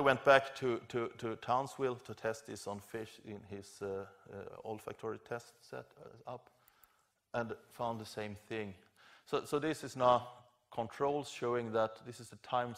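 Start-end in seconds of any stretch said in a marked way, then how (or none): none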